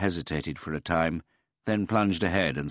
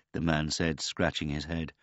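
usual speaking voice

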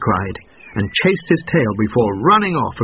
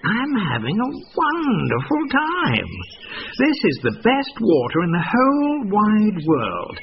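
sly salesman; 12 semitones higher than usual. A narrator's reading. Fox tricking the goat.

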